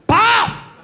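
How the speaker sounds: very high loud popping "pa"; fart noise. Retold or imitated. Comic imitates grandma's loud fart, high and popping. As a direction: blast out a mouth fart.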